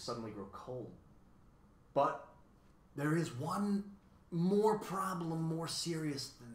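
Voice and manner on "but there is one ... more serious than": ordinary delivery, nothing marked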